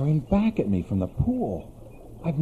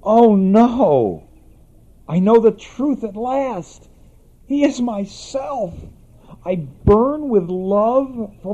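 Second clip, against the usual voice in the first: despairing lament; 7 semitones higher, 5 dB louder than usual. A narrator's reading. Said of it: "grief on knowing the truth".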